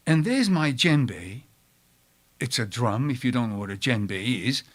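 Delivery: ordinary talk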